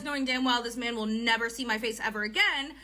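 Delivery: usual speaking voice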